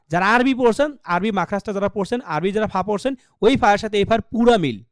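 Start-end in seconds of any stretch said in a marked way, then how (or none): none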